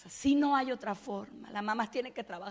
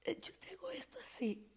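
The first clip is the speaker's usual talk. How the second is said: much higher, softer whispered voice of the injured woman; imitating her tone; 11 semitones higher, 9 dB quieter than usual. A pastor imitates the paralysed woman telling her story: high soft whisper. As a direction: mimic the paralysed woman's fragile way of speaking. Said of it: "en su tono, así hablando".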